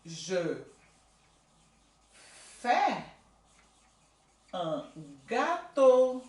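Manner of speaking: je, fais, un gâteau spaced out slowly; teacher dictating slowly; word by word. Shown as a demonstration. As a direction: dictate each word apart. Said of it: "por exemplo".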